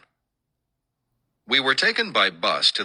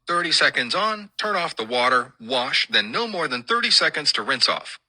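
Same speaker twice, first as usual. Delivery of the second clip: higher, lightly mimicked official orders; imitated strict instructions; 7 semitones higher than usual. Colonel briefing newcomers on Navy showers, higher-pitched imitated instructions. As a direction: recite the rules pitched up, step by step.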